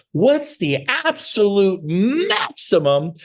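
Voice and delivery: high, very slow exaggerated question; mock explaining. Coach talking down to open-house agents, mock explaining. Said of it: "I talk to 'em like this".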